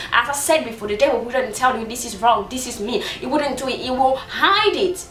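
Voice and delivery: ordinary delivery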